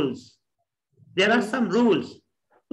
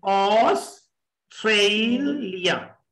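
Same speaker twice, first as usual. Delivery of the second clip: Australia slowly in three parts, aws, trei, lia; split into syllables; much slower than usual. Shown as a demonstration. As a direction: break Australia apart with pauses. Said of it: "Australia में तीन syllable हैं". (Australia रुक-रुक कर तीन हिस्सों में)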